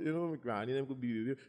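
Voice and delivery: normal talking voice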